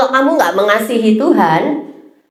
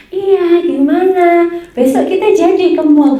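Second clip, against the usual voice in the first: higher, bright eager voice; eager chat; 6 semitones higher than usual. Retold or imitated. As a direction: sound keen, chatting up someone you like. Chatting brightly with crush.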